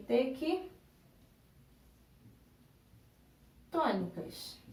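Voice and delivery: "tequitônicas" softly, with a very long break inside; dictating while writing. Shown as a demonstration. Teacher writing the word for pupils, "tequitônicas" slowly and softly in pieces. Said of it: "Então, eu vou escrever".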